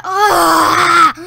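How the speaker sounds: loud, drawn-out "Ah!"; frustrated yell. Real, not acted. Yells after a missed chance in game.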